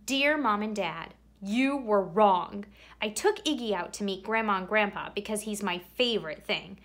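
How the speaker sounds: ordinary delivery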